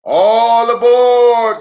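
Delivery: high, loud, slow drawn-out call; stretched conductor's call; all aboard call. Acted. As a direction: sing it out like a conductor. Lem calls everyone aboard.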